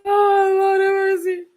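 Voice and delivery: high, loud, laughing cry of disbelief; laughing exclamation. Real, not acted. Laughing at a viewer's comment, high and loud. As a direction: burst out laughing to the heavens.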